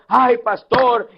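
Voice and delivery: slow, drawn-out mock lament; mock tearful wail. Church members crying to their departing pastor, mock wailing. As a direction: whine it out slowly, imitating weepy parishioners. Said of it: "No me llores tanto".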